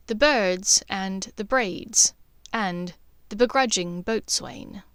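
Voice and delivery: usual speaking voice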